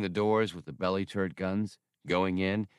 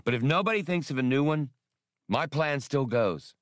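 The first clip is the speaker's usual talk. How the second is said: firm ultimatum; 6 semitones higher than usual. A narrator's reading. Han to the crew, a firm ultimatum. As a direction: lay down the final word.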